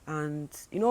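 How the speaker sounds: ordinary delivery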